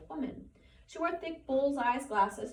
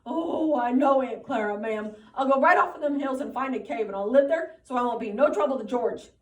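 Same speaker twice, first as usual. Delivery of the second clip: moaning with grief; 3 semitones higher, 11 dB louder than usual. A narrator's reading. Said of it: "Lenny moaned with grief".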